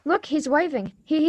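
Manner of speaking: normal talking voice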